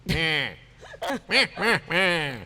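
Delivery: high bleating mock "meh". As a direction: bleat it to tease. Teasing with meh sound.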